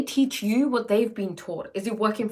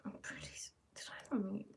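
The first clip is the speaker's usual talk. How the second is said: whispering; 17 dB quieter, faster than usual. Real, not acted. Muttering to herself, unsure.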